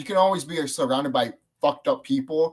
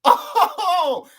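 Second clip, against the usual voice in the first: long high, loud "oh" with laughter; re-enacted howl of disbelief; amazed whoop; 6 dB louder than usual. Re-enacts reaction to the scene.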